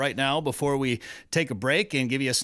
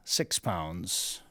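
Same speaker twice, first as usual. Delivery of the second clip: quiet aside; 7 semitones lower, 7 dB quieter than usual. Acted. Joke answer dropped as aside.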